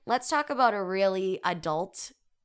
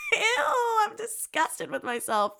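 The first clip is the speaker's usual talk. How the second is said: disgusted; 13 semitones higher than usual. Real, not acted. Describing poking the stool.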